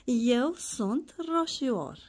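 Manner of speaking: put-on slow, drawn-out recital for a small child; teaching a child a poem line by line. Shown as a demonstration. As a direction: read each word out slowly so the child can copy it. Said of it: "Mai spunem o dată poezia? Hai după mami".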